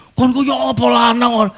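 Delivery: high, mock-indignant wife; imitated scolding wife. Wife challenging her height-shy husband, mock scolding shout. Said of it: "kalau nyonya saya mau".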